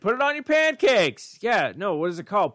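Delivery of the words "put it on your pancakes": high, slow nagging mom. Pretend. Nagging repeated command.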